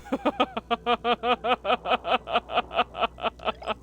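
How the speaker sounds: long, helpless run of giggles; laughing. Real, not acted. Player amused by her mermoo skit, laughing. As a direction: keep giggling until out of steam.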